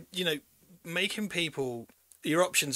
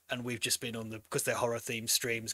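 ordinary delivery

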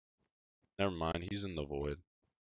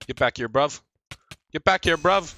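raised call climbing louder and higher; calling out, building; 9 semitones higher, 11 dB louder than usual. Real, not acted. Gamer yelling after a fleeing opponent, louder each time. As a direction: holler after someone getting away.

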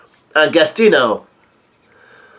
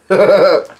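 amused laugh; 5 semitones higher, 3 dB louder than usual. Real, not acted. Reacting to the manga panel.

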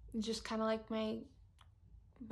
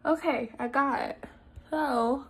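talking with mouth held open; expander key in mouth; 2 semitones higher, 6 dB louder, slower than usual. Real, not acted. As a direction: tool pressed against palate. Seen 2: Thin key held in mouth throughout; mouth wide open turning expander.

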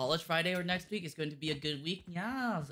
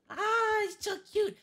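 cutesy nya speak; 9 dB louder, slower than usual. Real, not acted. Cooing over cute game item.

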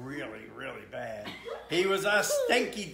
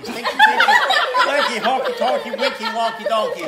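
louder, higher and giggling through it; laughing; 7 semitones higher, 15 dB louder, faster than usual. Real, not acted. Dad reading to the kids, giggling loudly. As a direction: push the rhyme out through real laughter.

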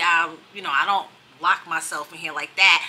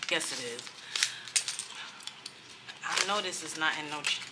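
ordinary talk, nothing marked

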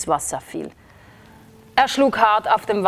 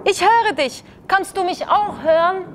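high, eager call to someone far above; little frog shouting up into the storm; 10 semitones higher than usual. A narrator's reading. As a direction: call up hopefully. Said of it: "rief der Frosch zurück".

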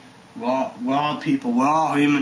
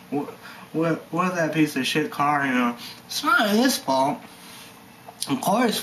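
ordinary talk

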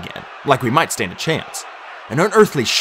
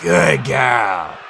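low-pitched voiced character; praising a beast; 4 semitones lower than usual. A narrator's reading. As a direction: call low praise to a huge dog. Newcomer praising the hellhound.

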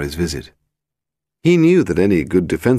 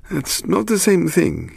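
high, drawn-out objection; defensive protest; 13 semitones higher, slower than usual. Acted. Sweating suspect to policeman, high slow defensive voice. Willie protests when accused.

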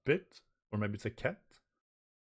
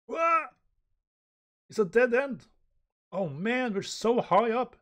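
high, loud cry of dismay; alarmed character; 13 semitones higher, 6 dB louder than usual. Acted. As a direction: voice a cornered manga hero, pitched up.

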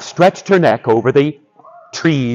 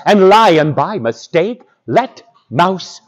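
plain reading voice

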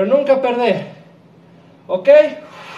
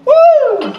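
high, loud whoop of energy; excited whoop; 5 dB louder than usual. Real, not acted. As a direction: let out a hype whoop. Coach whooping mid workout. (grito de euforia)

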